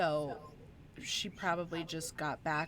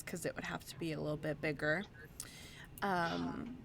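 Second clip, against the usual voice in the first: ordinary talk, nothing marked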